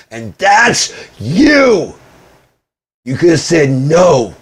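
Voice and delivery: high, loud, stretched-out key words; accusing shout. Real, not acted. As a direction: hurl the blame at them loudly. Blaming partner in angry rant.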